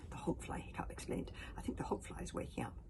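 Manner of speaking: quiet unvoiced warning; whispering. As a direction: whisper it softly. Hiccup whispering in danger.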